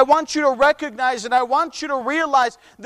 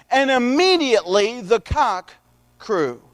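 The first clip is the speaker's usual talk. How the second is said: slow, drawn-out Bible reading; scripture reading; 3 semitones higher, slower than usual. A narrator's reading. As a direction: read the verse deliberately, stretching the quoted words. Reads scripture aloud.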